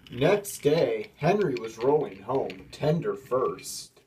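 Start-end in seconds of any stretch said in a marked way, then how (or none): none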